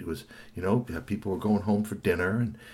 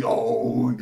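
plain reading voice

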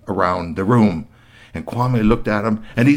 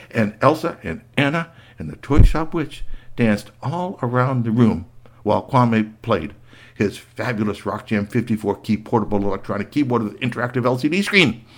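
usual speaking voice

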